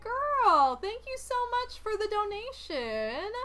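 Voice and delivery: high, excited gush with a loud opening; gushing thanks. Real, not acted. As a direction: gush at a generous donor.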